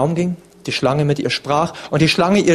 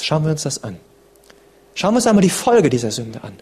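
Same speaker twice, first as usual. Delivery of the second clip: ordinary delivery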